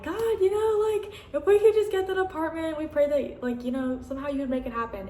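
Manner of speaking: high, earnest pleading to God; high begging prayer that eases down. Retold or imitated. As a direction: pray up high, hoping hard. Retold prayer for the apartment.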